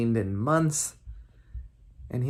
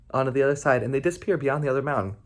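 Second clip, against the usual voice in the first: ordinary delivery, nothing marked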